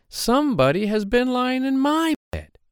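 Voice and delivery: high-pitched mother bear at moderate volume; middle-sized Mama Bear. A narrator's reading. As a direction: lift it high, without shouting, as the mother bear. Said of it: "said the Mama Bear in her middle-sized voice".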